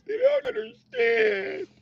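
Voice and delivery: very high, drawn-out squeal; mock women's whine. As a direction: mimic women complaining, slow and squeaky. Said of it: "That's women's favorite line".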